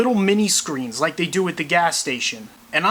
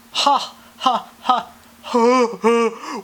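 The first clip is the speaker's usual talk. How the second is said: mechanical fake laugh; 4 semitones higher, much slower than usual. Robot Kimmel laughs.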